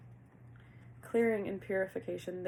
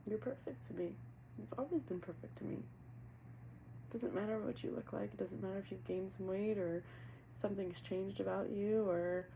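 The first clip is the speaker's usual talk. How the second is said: much softer, tender, speaking for the spirit; soft channeled message; 5 dB quieter than usual. Acted. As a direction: murmur it gently as if the partner were speaking. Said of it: "I feel like I'm channeling now".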